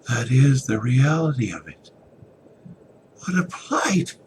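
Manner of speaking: high, loud breaking cry. Acted. Evan locked away, crying out his plight. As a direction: let the despair break into a sob.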